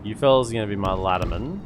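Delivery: ordinary delivery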